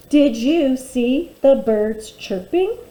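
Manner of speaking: slow, even check read; teacher modelling a careful reading; slow read-aloud. Shown as a demonstration. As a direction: read it slowly word by word to the class. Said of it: "Let's read that sentence together".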